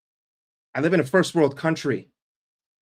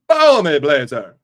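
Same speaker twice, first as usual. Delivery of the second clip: shouted catchphrase; 10 dB louder, slower than usual. Shown as a demonstration. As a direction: belt it out across the field. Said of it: "you have to say it like that".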